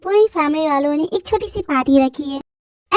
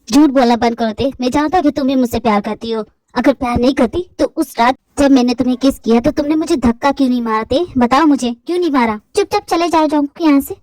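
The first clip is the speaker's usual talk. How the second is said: put-on lower, insistent young man demanding an answer; angry young man; 5 semitones lower than usual. Boyfriend confronting girl about the kiss, lower insistent male voice. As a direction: press her for the truth.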